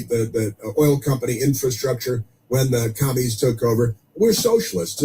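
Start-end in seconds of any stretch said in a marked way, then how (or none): 4.2-5.0 mock socialist. Mocking the left's slogan.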